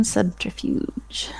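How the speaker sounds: slow whispered word; whispering. Real, not acted. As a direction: whisper it slowly to yourself. Reading the dice roll.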